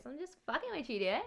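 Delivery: a hint of a teasing friend; Rosa voice. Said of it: "In my Rosa voice".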